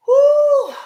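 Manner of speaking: high, loud whoop; a sudden loud whoop of relief. Real, not acted. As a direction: let out a shrill woo. Exclaims after tense story.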